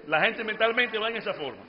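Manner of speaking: ordinary talk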